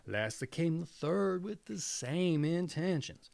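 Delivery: ordinary delivery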